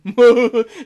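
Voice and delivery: loud rising mocking laugh; villain laugh. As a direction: laugh at him loudly. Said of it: "[name] laughs from beside me".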